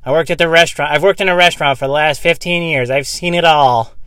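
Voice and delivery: lightly voiced braggart; mocking a self-proclaimed veteran; mock know-it-all. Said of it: "when people are like".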